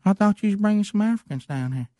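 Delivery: Gary's voice. Retold or imitated. Gary voiced in story.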